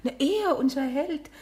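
thrilled outburst about the hero; gushing excitement. Acted. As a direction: swoon over her hero.

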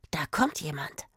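soft, low whisper. A narrator's reading. Girl whispering that someone is coming. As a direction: whisper so nobody hears.

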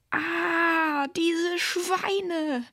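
high-pitched, furious screech; outraged screech. A narrator's reading. Tamara walks in on the break-in, screeching. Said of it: "kreischte Tamara".

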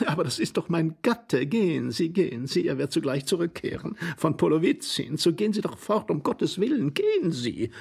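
high, anxious lady's plea; urgent pleading. A narrator's reading. Lady begs Tworogov to go before husband returns, urgent. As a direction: urge him away in a high voice.

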